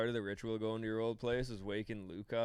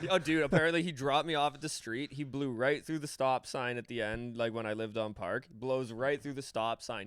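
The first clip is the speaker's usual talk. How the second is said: usual speaking voice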